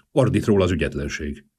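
ordinary delivery, nothing marked

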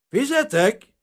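high, loud call; shout. A narrator's reading. Grain dealer wanting the bill, shout. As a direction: call across the room for the waiter. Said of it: "kiáltott Aronson".

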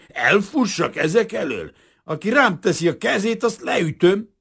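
high, loud, defiant outburst; angry threat. A narrator's reading. Kazimír refusing to run from detectives, angrily defiant. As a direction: refuse to flee, loud and menacing.

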